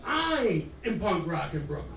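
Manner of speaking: ordinary delivery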